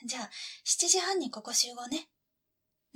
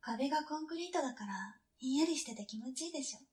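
whispering; 8 dB quieter, faster than usual. Acted. Whisper in the basement.

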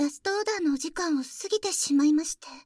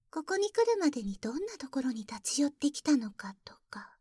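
whispering. Acted.

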